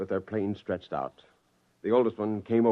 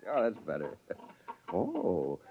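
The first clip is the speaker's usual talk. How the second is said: ordinary talk, nothing marked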